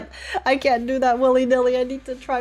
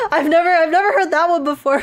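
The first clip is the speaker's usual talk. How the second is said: giggling through the words, pitched up; laughing; 7 semitones higher, 5 dB louder than usual. Real, not acted. Streamer laughing at a chat message about goofy glasses, laughing. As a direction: laugh it out, tickled by the compliment.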